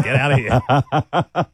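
mock cop. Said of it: "just said".

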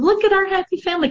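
high, fast, sing-song fake cheer; sarcastic bright caption voice; mock cheerful. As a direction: chirp it like a staged happy post. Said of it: "I would do Facebook photos of".

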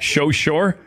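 "So" mockingly turned into "sho", rising at the end; imitated slushy S. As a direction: mimic "So sure" as "sho shore". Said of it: "a little slushy yes there".

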